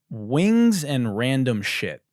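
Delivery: drawn out, deadpan name; deadpan slow announcement; slow name read. Acted. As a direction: spell out the absurd name slowly. Reading a silly restaurant name.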